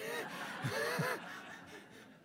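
breathy high-pitched laugh; high giggling laugh. Real, not acted. Preacher laughing at the question, high and breathless.

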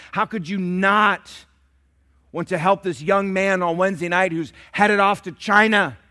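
rising preacher's appeal; full-force preaching. Real, not acted. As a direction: press the church to give.

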